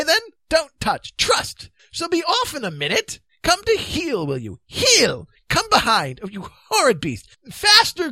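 high, loud, excited commands; flustered king. Acted. King holding the beast back while knights run, flustered shouting. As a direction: shout frantic scolding and warnings.

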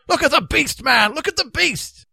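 high, loud cry; urgent warning call. A narrator's reading. Stranded knight calls out urgently.

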